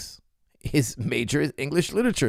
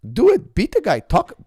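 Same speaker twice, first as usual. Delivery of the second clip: much higher and louder, punchy commands; fired-up challenge; 10 semitones higher, 6 dB louder than usual. Real, not acted. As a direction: dare him, hit each word hard. Challenging him to debate.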